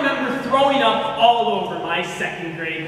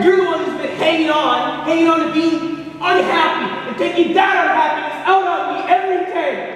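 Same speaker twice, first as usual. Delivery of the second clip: loud, higher accusing yell; angry shout; 7 semitones higher, 4 dB louder than usual. Acted. Husband shouting his blame at his wife. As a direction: throw the blame at her full force. Heated marital argument.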